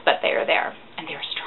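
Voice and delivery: soft, fading to a bare whisper; hushed confession. Real, not acted. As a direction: drop away into a whisper. Mock confession of fangirl feelings.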